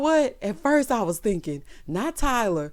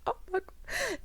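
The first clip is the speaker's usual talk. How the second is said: breathless swoon; 12 semitones higher, 11 dB quieter, slower than usual. Retold or imitated. Swooning at his romantic line.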